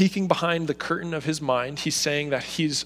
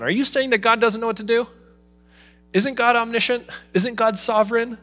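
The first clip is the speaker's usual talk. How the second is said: higher, fast, challenging skeptic voice; a sceptic in the room questions the preacher, challenging; doubting skeptic; 8 semitones higher, 5 dB louder than usual. Retold or imitated. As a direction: push back like a doubter in the pews. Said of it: "the skeptics in the room who are gonna say".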